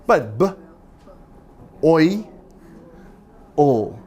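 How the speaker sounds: "b", "oi", "o" pulled apart slowly; sounding out boil. Shown as a demonstration. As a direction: split the word into its sounds. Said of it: "It sounds like O E".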